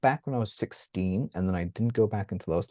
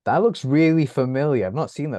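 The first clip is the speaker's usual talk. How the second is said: mock surprise; 8 semitones higher, 6 dB louder than usual. Friends' reaction on video calls.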